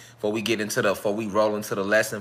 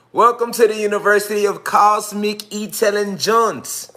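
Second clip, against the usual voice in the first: "Welcome to the University of Cosmic Intelligence" in a lifted showman's voice; higher, grander announcement; 8 semitones higher, 4 dB louder than usual. Shown as a demonstration. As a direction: welcome pitched up and louder. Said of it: "We gotta enunciate it different".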